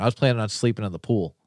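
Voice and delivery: usual speaking voice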